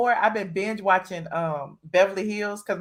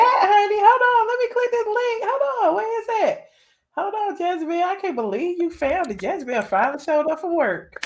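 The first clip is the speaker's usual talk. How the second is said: high-pitched excited rush of discovery; thrilled, voice jumping up high; 9 semitones higher than usual. Real, not acted. Host thrilled a listener found the link, excited. Finds long-awaited link in chat.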